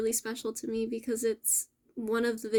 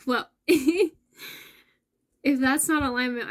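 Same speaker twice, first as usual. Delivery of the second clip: laughing quote; 2 semitones higher, 5 dB louder than usual. Retold or imitated. Recalling seeing eleven eleven, laughing. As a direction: laugh it out in disbelief. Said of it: "And I was like".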